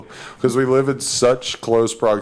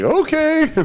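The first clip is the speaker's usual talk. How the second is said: squeaky okay. Retold or imitated. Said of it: "It's just like".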